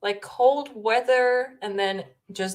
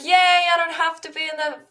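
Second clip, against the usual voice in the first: mock cheer; 9 semitones higher, 5 dB louder than usual. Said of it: "you were like".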